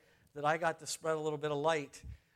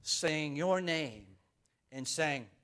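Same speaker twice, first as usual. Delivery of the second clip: usual speaking voice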